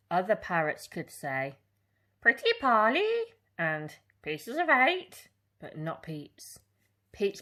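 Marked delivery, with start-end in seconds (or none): 2.2-3.1 high squawky parrot call; proud parrot repeating its phrase. Acted. Parrot's words in story. 4.2-5.3 high squawky parrot call; proud parrot repeating its phrase. Acted. Parrot's words in story.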